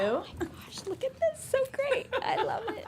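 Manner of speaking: high, squealing with delight over a gift; delighted opening a present. Real, not acted. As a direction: gush over the present, pitch way up.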